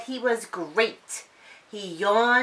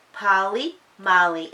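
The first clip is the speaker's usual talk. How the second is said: ordinary delivery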